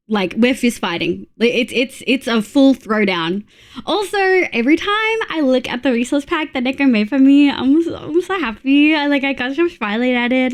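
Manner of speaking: ordinary talk, nothing marked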